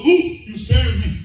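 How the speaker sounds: high, loud comic startle; mock fright. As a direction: play a grown man pretending to be spooked. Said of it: "Not all of them go".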